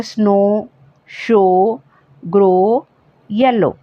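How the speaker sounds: स्नो शो ग्रो येलो ending on a long o. Shown as a demonstration. Teacher listing ow words that end on a long o. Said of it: "sound ओ की होती है".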